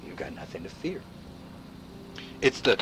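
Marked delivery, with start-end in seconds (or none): 0.1-1.0 hushed, menacing whisper; ominous whisper. Acted. Warning the audience, in an ominous whisper. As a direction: breathe the threat out in a whisper.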